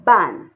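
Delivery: Ban loud with a sharp fall in pitch. Shown as a demonstration. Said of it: "do you remember the falling tone?".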